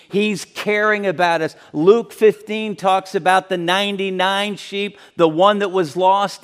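loud, driving sermon build; fervent preaching. Acted. Preacher rousing the congregation about the lost sheep, loud and fervent. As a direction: preach it hard to the back row.

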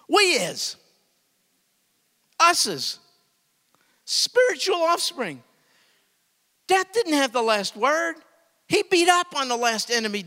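loud, high, fervent, near shouting; full-force preaching. Real, not acted. Preacher to congregation about offspring, loud fervent shouting. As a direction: preach the resurrection with everything. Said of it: "I'm making babies cry".

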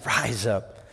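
king speaking tenderly to his bride. Acted. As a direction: say it like a tender lover. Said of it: "He says".

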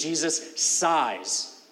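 slow, drawn-out sighs; Jesus sighs said with a slow breath; slow sighing. Shown as a demonstration. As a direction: sigh the word out slowly. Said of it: "that same word is being used".

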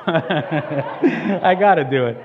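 loud, slow words through real laughter; laughing. Real, not acted. Preacher who could not resist the impression, laughing. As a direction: laugh while owning up.

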